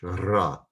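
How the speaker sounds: ra with a trilled, rolled R; rolled Spanish R. Shown as a demonstration. Teacher showing what Resh is not, rolled Spanish R in ra. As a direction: roll the R in ra as in Spanish. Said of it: "It's not rolled like in Spanish".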